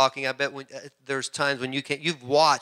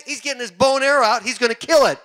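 excited villager; 8 semitones higher, 4 dB louder, faster than usual. Retold or imitated. Villager retold excited by pig picture.

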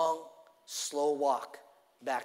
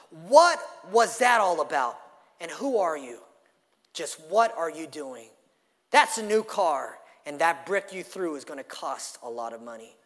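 angry shouting driver; 8 dB louder than usual. Acted. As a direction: bark the questions at the kid.

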